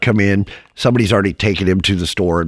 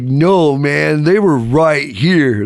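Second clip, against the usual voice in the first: higher, drawn-out stoner protest; imagined stoner voice; 5 semitones higher, much slower than usual. Retold or imitated. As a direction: plead slowly like a baked hippie. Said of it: "he didn't talk like that".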